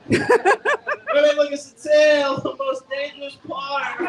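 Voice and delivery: slow, loud, laughing through it. Real, not acted. Handler teasing the crowd, laughing loudly. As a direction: laugh while pointing them to the tail. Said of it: "Well, move".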